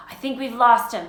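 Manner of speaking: lightly puffed after running; panting. A narrator's reading. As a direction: say it as if winded from fleeing. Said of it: "Harry panted".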